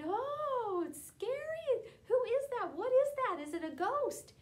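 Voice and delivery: high, loud frightened wail; scared cry. Acted. Apostles in the storm crying out, high and scared. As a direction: cry out in terror.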